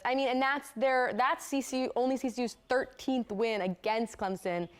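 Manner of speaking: ordinary talk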